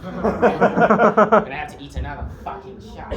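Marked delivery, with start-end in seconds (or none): 0.0-1.5 laughing. Acted. Villain laughs in defeat.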